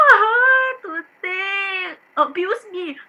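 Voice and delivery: high, hammed-up wail; wailing plea. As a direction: wail it out melodramatically. Employer wails dramatically.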